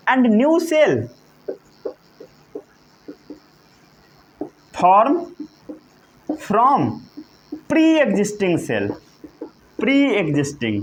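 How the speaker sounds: very slow, phrase by phrase with long pauses; stretched, carefully separated words; slow dictation. Shown as a demonstration. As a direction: dictate for people writing it down. Teacher dictates for note-taking.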